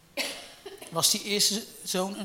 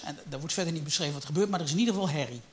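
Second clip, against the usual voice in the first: ordinary delivery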